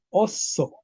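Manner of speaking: "oso" with a long, held double s; medieval Spanish pronunciation. Shown as a demonstration. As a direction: stretch the s in "oso", os-so. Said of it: "En español medieval se decía". ("oso" medieval, con s doble y alargada)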